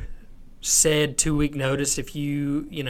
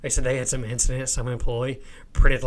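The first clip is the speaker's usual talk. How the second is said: usual speaking voice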